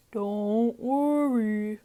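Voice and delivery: put-on slow, calm old grandpa voice; old grandpa sheep. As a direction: soothe them slowly like a wise old grandfather.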